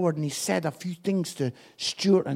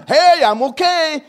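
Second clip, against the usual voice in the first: loud, slow fake-bright "I'm okay"; after-service answer hiding feelings, hammed up; mock cheerful reply; 7 semitones higher, 10 dB louder than usual. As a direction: put on a brave church-door smile. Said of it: "How you doing?".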